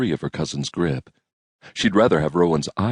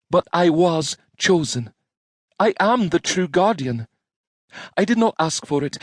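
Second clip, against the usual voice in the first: put-on firm young woman voice; 12 semitones higher than usual. Rowan firmly asserting herself.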